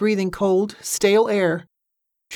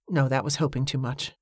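usual speaking voice

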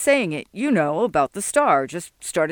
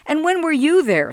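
higher, louder envious snap; snippy retort; 9 semitones higher, 4 dB louder than usual. Acted. Sol to Jesse, envious and snippy, higher and louder. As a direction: cut him down with the question. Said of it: "Sol snipped".

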